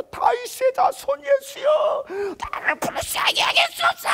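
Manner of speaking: slow, very high desperate cry; Bartimaeus crying out. Acted. Blind Bartimaeus crying out to Jesus, wailing. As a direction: wail it to Jesus from afar. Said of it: "바디메오가 막 부르짖었잖아요".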